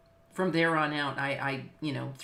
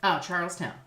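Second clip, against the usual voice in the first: ordinary talk, nothing marked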